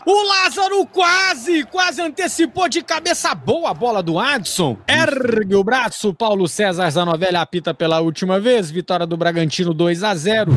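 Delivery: radio match commentary; live play-by-play. Acted.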